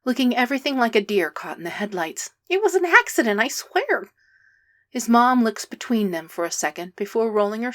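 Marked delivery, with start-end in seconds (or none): 2.5-4.1 high, louder frantic protest; panicked pleading. A narrator's reading. Boy pleading with the pack, high panicked voice. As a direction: blurt it out, scared of being blamed. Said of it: "Stiles squeaks out".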